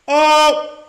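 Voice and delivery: loud, high "oh" shout; startled yell. Real, not acted. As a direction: cry out at the blast. Reacting to explosion.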